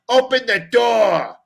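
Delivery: retold stern demand. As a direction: lean on each word like an angry father. Dad demanding again, slower.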